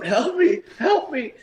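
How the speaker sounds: high, pleading mock cry; imitated cry for help. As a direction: whimper it out like a beaten man begging. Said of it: "comes rolling down the stairs screaming".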